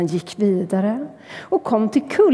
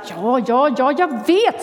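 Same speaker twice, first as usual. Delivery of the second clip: a touch of sulky impatience; 3 semitones higher than usual. Acted. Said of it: "sa igelkotten".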